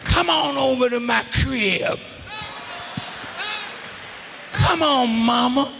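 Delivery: low crooning come-on to a woman; a hint of a man sweet-talking a lady; smooth pickup line. Man calling a woman over.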